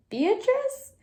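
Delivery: sharp high surprised rise; suddenly alarmed dad; startled question. A narrator's reading.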